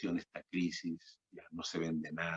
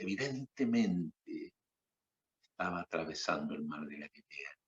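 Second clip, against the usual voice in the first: usual speaking voice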